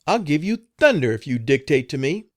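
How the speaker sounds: high, loud furious threat; in a rage. A narrator's reading. Madam threatens the cook in a rage, high and loud. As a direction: threaten her in a fury. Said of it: "in a rage".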